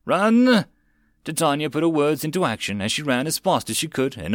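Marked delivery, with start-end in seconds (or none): 0.1-0.6 high, loud desperate command; urgent shout. A narrator's reading. Lady fleeing the mob, urgent shout. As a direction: yell it to save them all.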